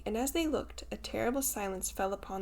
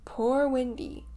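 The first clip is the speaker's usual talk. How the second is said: moaning; 4 semitones higher, 5 dB louder, slower than usual. A narrator's reading. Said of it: "it moans".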